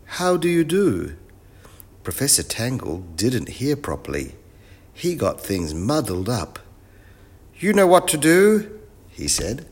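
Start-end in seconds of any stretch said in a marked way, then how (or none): 0.0-1.2 put-on high child's greeting, mildly shouted. A narrator's reading. Wilf repeats his greeting for the professor, mild high shout. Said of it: "shouted Wilf". 7.6-8.8 loud, very high professor voice; muddled professor. Acted. Professor mishears the greeting, answers loud and high.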